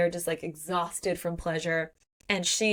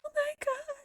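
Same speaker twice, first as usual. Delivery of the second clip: very high, soft squeal; overwhelmed squeak; 5 dB quieter than usual. Real, not acted. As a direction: let out a tiny, high-pitched squeak. Host overwhelmed by the scene.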